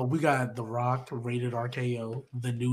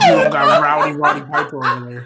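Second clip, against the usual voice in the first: exasperated yell; 9 semitones higher, 13 dB louder than usual. Real, not acted. Teammate protesting at being laughed at over the roster, exasperated yell. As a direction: stretch the words out in a loud, high holler.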